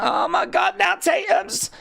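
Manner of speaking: excited shout. Real, not acted. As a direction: yell it out as the chaos erupts. Shocked by the action in the ring.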